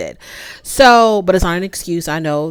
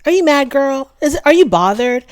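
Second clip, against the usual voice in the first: mock sweet needling, pitched up; a hint of smug condescension; patronizing taunt; 7 semitones higher than usual. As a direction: tease her as if talking down. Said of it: "Stormy got more patronizing".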